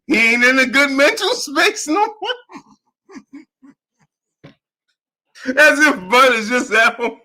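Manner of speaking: high voice squeezed by helpless laughter; laughing to tears. Real, not acted. Host retelling the quote to Nick J, laughing to tears. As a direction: keep breaking up as you retell it. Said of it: "I'm literally in tears".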